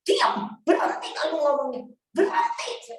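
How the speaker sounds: tearful, high pleading to stop; begging through tears to stop the fight; crying angry plea. Retold or imitated. Wife to husband mid-quarrel, crying and pleading. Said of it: "kami lagi ribut".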